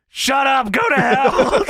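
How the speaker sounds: mock yell. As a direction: yell it like an angry wrestler, half in jest. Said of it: "by yelling".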